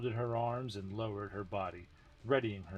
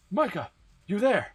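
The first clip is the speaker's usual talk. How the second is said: higher, louder voice calling over the radio; sharp radio voice; 11 semitones higher, 10 dB louder than usual. Acted. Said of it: "the sharp voice over the radio".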